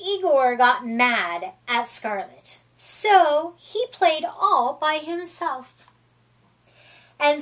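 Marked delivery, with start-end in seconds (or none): none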